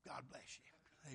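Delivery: soft, slow whisper; whispered blessing. Retold or imitated. Preacher whispering a blessing to the man as he walks off. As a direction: breathe it gently as you leave.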